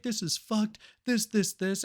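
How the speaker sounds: high, worked-up confession; agitated mock rant. Crook in the interrogation room, agitated. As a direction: act out a crook blurting everything.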